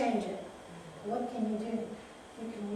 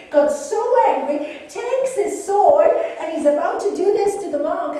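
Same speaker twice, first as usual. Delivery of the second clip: loud, high, furious telling; angry narration; 7 semitones higher, 13 dB louder than usual. A narrator's reading. Telling how the samurai drew his sword, loud. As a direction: tell it as the rage boils over.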